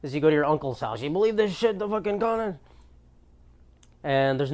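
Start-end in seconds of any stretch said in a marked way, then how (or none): 1.0-2.6 higher put-on dad voice griping; grumbling dad. Dad complaining at his uncle's house, hammed-up dad gripe. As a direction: do a dad ranting about the power company. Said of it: "this is like a dad complaint".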